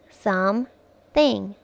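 "Some-thing" slowly as two separate parts; broken into syllables. Shown as a demonstration. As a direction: leave a clear pause inside "Some-thing". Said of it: "break it into its parts".

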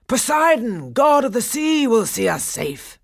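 high, loud appeal to the god; panicked cry. A narrator's reading. Pirate chief calling on Poseidon in panic, loud cry. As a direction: shout it out while fleeing. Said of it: "he cried".

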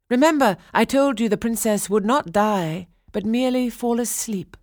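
high, emphatic fairy voice; insistent fairy. A narrator's reading. Lilac fairy reminds the king of her promise, insistent.

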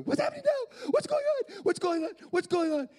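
put-on very high, loud kid squeal; rapid-fire squealed questions; frantic little boy. Boy as the plane revs and shakes, frantic kid. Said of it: "there's this little boy".

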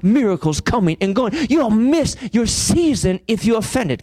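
high, firm toughen up; a hint of a stern father; stern God. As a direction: push them hard like a stern father. God's stern imagined words.

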